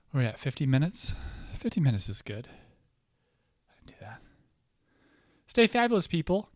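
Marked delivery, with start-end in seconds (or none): 5.5-6.5 high, loud bubbly goodbye; sassy sign-off. Real, not acted. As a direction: sing out a fabulous farewell. Sign-off to viewers.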